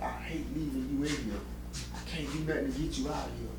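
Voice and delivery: hushed imitation of mom's helplessness; softly retold, with a touch of sorrow; retold sorrowful parting. Mom leaving her son in prison, quiet sorrow.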